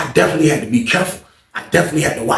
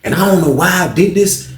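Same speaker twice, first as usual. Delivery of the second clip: normal talking voice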